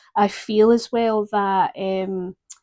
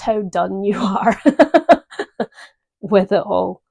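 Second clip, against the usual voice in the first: real laugh breaking into the words; 6 dB louder than usual. Real, not acted. Tarot reader telling the client how done they are, laughing. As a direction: crack up laughing partway through the phrase. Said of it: "I shouldn't be laughing".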